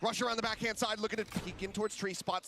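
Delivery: fast, high, hyped play-by-play; esports caster. Real, not acted. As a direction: call the clutch live, building hype. Live play-by-play of clutch round.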